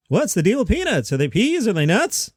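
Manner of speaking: lame, high sing-song stand-up impression; half-ass Jerry Seinfeld. Shown as a demonstration. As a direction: lilt the questions up high. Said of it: "So back to my lame".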